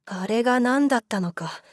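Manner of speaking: plain reading voice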